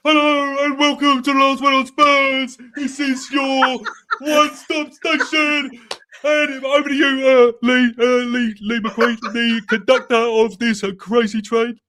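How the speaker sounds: high, loud, excited show host; Ricky Sacks. Acted. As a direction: open the show at full excited pitch. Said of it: "Excited Ricky Sacks".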